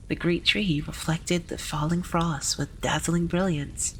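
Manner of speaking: ordinary delivery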